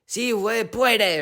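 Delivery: high mock cheering of Mexican fight fans; imitated fans screaming. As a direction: chant it high like an excited crowd. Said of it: "They're all screaming in Mexican".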